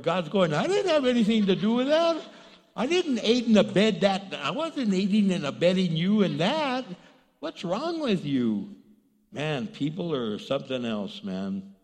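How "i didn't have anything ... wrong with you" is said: loud, acted disavowal of blame; mock indignant protest. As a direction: play God loudly protesting innocence. God disowns the thief, acted.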